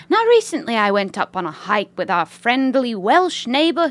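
put-on British lady accent. Reading a listener's email as a British lady, British accent. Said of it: "read this in a British accent".

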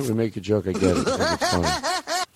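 usual speaking voice

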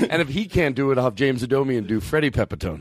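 ordinary delivery

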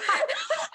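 high, broken laugh fighting back tears; a real laugh that tips into tears; teary laugh. Real, not acted. Host moved by guest's bathroom story, laughing through tears. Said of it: "I'm, like, getting emotional".

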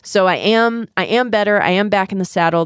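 usual speaking voice

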